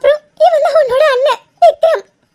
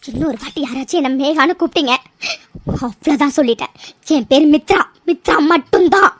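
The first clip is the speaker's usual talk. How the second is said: much lower, cold threat; low warning; 8 semitones lower than usual. Acted. Mithra warns family not to call her Mega, low cold threat. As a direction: warn them coldly, low and level.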